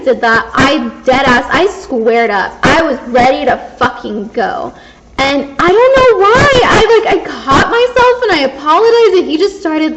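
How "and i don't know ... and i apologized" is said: high, loud laughing through the words; embarrassed laughing. Real, not acted. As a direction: laugh at yourself, mortified. Laughing embarrassed at own reaction.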